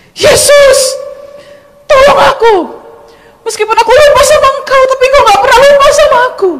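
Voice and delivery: high, full-voiced screamed plea; desperate shout. Woman pinned on the altar begs Jesus, desperate shouting. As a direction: cry out to Jesus at the top of your voice. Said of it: "saya langsung teriak".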